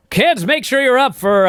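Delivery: put-on upbeat kids' show host plug, pitched high; Todd Pettengill. Host plugging the show to kids, high and chirpy. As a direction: imitate a peppy TV host addressing kids. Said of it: "Todd Pettengill's plugging the show and he goes".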